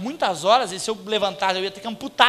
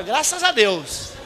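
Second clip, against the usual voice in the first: "graças a Deus" drawn out slowly, noncommittal; evasive drawl; much slower than usual. Shown as a demonstration. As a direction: stretch "graças a Deus" to dodge the question. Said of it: "nem sim nem não". ("graças a Deus" arrastado, evasivo)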